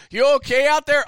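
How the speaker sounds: high, loud holler; teammate calling out. Retold or imitated. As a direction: imitate a shout from across the field. Teammates calling across the field.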